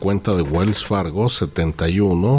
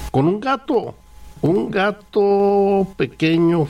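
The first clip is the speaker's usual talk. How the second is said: cutesy kitten voice; 13 semitones higher than usual. Acted. Describing a tiny scruffy kitten.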